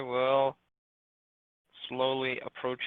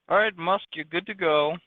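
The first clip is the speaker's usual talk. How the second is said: high, loud holler to a companion; calling out; 6 semitones higher, 10 dB louder than usual. Pretend. Adventurer sending Musk forward, loudly calling out. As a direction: send them in with a loud call.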